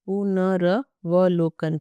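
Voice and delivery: "पुनर्वलोकन" drawn out syllable by syllable; very slow dictation. Shown as a demonstration. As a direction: dictate "पुनर्वलोकन" slowly so they can write. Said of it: "यहाँ लिख दो". (धीरे-धीरे बोलकर "पुनर्वलोकन" लिखवाना)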